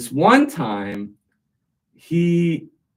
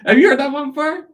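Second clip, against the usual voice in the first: excited question; 7 dB louder, faster than usual. Real, not acted. Teller excited after punchline.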